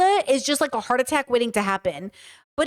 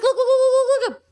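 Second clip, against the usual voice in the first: excited; 11 semitones higher, 4 dB louder than usual. Real, not acted. Urging the listener excitedly.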